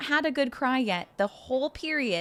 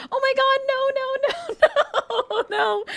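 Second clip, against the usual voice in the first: high, loud laughing no, no; laughing denial; 12 semitones higher, 5 dB louder than usual. Real, not acted. Laughing as she reassures the worried viewer. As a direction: laugh it off, waving away the worry.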